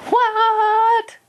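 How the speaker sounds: put-on a child copying mother's piercing "What?"; mimicking mother's yell. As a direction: throw the yell straight back at mom. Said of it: "mimicking me".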